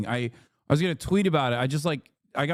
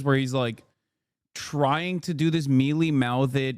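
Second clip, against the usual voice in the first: ordinary talk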